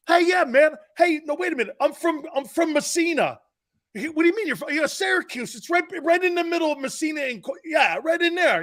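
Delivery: high-pitched, animated back-and-forth protest; mock protesting. Joking with Jerome about being from Messina, mock protesting. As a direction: playfully argue it, pitched well up. Acting out a hometown argument.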